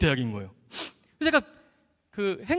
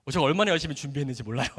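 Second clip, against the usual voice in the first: usual speaking voice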